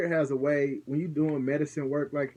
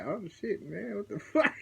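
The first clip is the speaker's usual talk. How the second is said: higher, laughing startled curse; mock startled; 7 semitones higher, 3 dB quieter than usual. Partner realizing his mistake, high startled and laughing. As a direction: act the partner catching his blunder. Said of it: "And he like".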